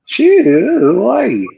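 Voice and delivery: very high, loud put-on holler; joke whoop. Friend joining in the yeehaw game, high joke whoop. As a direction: squeal it out as a playful accent joke.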